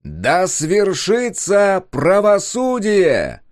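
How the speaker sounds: «Да свершится правосудие!» proclaimed very slowly and loudly, every word hit hard through a loudspeaker; «Да», «свершится», «правосудие» each punched, drawn out, booming high; every word stressed. A narrator's reading. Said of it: "подчёркивая каждое слово".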